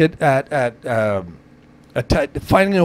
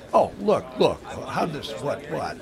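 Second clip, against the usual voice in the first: mock flustered customer, trailing off softer; retold movie customer; 9 dB quieter than usual. Retelling a car-return scene from Vacation, mock flustered and quiet. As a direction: lightly act out a bewildered car buyer, voice dropping away. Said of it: "Brings it back and goes".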